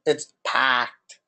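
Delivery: "packed" with a long flat A and no R; Boston accent. Shown as a demonstration. Correcting a friend on Boston vowels, in a Boston accent. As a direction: drop the R in "packed" and stretch the A. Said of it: "The A is the one that's emphasized".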